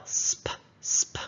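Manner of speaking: SP as a bare blend, said twice; Sp, sp as short separate sounds; isolated blend. Shown as a demonstration. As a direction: hiss the S into a popped P, no vowel voiced. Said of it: "I hear a blend".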